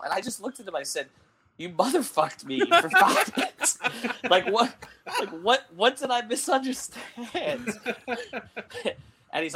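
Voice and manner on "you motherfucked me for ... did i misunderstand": laughing disbelief. Retold or imitated. Retold confronting coach, laughing.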